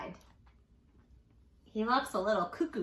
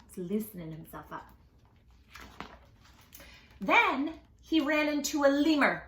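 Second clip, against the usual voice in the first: usual speaking voice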